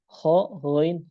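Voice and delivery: ordinary talk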